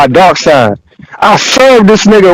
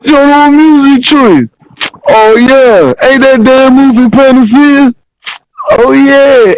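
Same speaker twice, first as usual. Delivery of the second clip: high, drawn-out mock amazement from a homie; pitched-up, slowed, teasing buddy voice; imitating Mark hyped up; 8 semitones higher, slower than usual. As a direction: play the friend who can't believe there's no video. Friend Mark's reaction, voiced in story.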